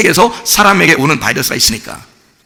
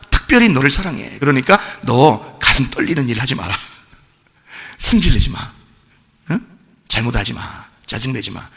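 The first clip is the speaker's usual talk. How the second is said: God speaking tenderly; 5 semitones lower, 4 dB quieter than usual. Acted. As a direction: speak as a caring father to a child. Voicing God's loving command. (아이를 타이르는 아버지처럼 부드럽고 낮게)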